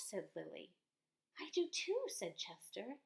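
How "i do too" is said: high eager agreement; eager child voice. Acted. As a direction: chime in happily. Chester answers eagerly.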